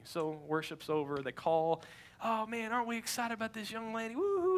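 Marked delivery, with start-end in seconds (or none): 2.2-4.6 higher-pitched hyped-up crowd rallying; rally the room, ending on a high whoop; excited pastor. Retold or imitated. Pastor to the church about the young lady, excited.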